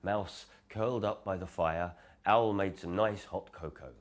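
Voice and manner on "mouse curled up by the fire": ordinary delivery, nothing marked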